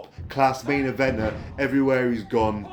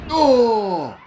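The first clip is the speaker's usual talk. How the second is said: high, loud oh; excited oh; 10 semitones higher, 7 dB louder than usual. Real, not acted. Commentator seeing the Disorder driver, loud high oh. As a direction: shout a startled oh.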